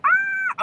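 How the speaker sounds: sudden high shriek; startled scream. Real, not acted. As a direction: jump and shriek in fright. Startled while cleaning.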